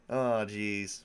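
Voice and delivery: ordinary talk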